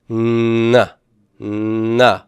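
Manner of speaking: long nasal hum on the N of "Nah", then a short ah; drawn-out N sound. Shown as a demonstration. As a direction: hold the N in "Nah" before opening to the vowel. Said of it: "do an N sound like that".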